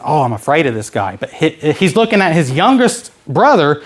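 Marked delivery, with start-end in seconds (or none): none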